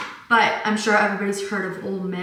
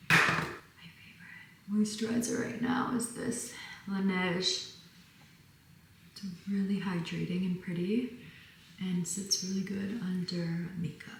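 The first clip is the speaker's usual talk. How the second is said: much softer, almost whispered; near-whisper skincare chat; soft product talk; 10 dB quieter than usual. Real, not acted. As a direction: drop to a hush over the product. Quiet ASMR-like product talk.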